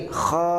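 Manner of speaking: kha from the throat, a scraped kh near the tongue root; teacher's model kha. Shown as a demonstration. Teacher demonstrates kha to the student. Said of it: "Baik, sekali lagi".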